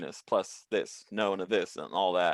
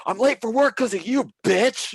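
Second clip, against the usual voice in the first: much higher, loud insulting yell; angry shout; 13 semitones higher, 5 dB louder than usual. Retold or imitated. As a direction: hurl the insult at the cop. Driver arguing with the cops.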